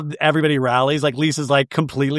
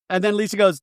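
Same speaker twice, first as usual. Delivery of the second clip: ordinary talk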